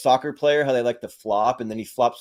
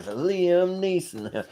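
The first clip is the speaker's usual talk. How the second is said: drawn-out name; 6 semitones higher, 3 dB quieter, much slower than usual. Pretend. Fan naming a favourite sketch, drawing "Liam Neeson" out slowly.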